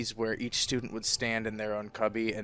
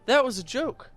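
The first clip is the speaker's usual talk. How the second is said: higher, louder upbeat bro voice; mock friendly bro; 11 semitones higher, 7 dB louder than usual. Teaser making up with the teased kid, bright and loud. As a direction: play the cheerful pal smoothing it over. Acting friendly after teasing.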